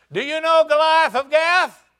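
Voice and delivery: David shouting a taunting question; hollered question. Retold or imitated. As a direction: call it up to the wall. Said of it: "he hollers and says".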